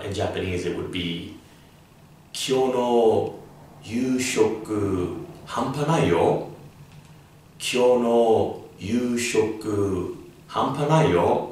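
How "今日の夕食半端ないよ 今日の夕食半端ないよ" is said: higher, louder and slow; amazed. Shown as a demonstration. Teacher demos hanpanai about dinner, amazed. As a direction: savour it like an amazing meal. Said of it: "out of this world".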